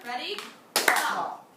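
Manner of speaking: leading the class to clap it; clapped word. Shown as a demonstration. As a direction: Pop said short and loud on a clap. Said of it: "let's do it together".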